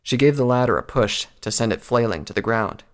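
ordinary delivery

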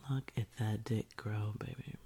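ordinary talk, nothing marked

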